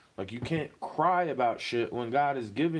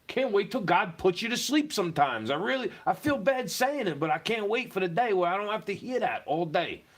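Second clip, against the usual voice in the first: angry rant; 6 semitones higher than usual. Real, not acted. Cursing the noisy neighbours who keep him awake, furious. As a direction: vent hard at the demons next door.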